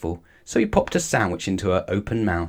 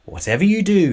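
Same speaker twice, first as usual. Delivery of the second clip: out of breath; 9 semitones higher, 5 dB louder than usual. A narrator's reading. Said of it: "he gasped".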